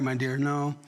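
ordinary talk, nothing marked

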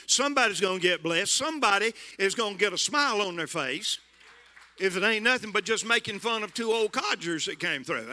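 rally the congregation with rising fire; fired-up preaching. Acted. Old preacher rousing the congregation, full preaching force.

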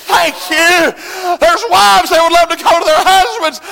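loud, high, shouted sermon; fiery revival preacher shouting; full-force preaching. Acted. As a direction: preach it hard at the congregation. Preaching builds again.

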